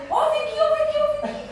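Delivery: high and loud, urgent; urgent high call. Retold or imitated. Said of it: "eu falei". (chamando alto e agudo, com urgência)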